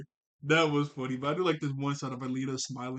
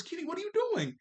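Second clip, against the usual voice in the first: much higher, puzzled question to a pet; mock puzzlement; faster than usual. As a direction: imitate catching the dog up to something. Acting out Odd questioning the dog.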